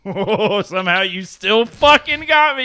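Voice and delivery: loud, high burst of mock outrage; exasperated shout. Real, not acted. Friend yelling that the joke got him. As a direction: yell it, caught out by the joke.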